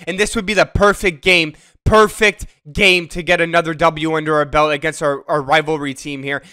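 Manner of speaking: usual speaking voice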